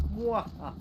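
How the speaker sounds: high evil villain cackle; mustache twirling villain. Pretend. Gloating at not needing map.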